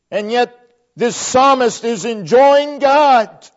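building passionate sermon peak; fervent preaching. Acted. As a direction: preach it with rising fire.

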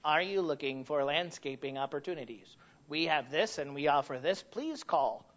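imitating a robot voice. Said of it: "you just hear this robotic voice".

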